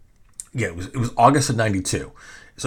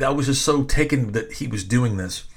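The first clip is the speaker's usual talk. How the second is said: normal talking voice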